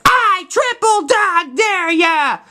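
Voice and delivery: high, slow, drawn-out dare; high, slow schoolyard taunt at recess; taunting kid dare. Retold or imitated. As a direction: stretch the dare out to goad him. Schwartz dares Flick.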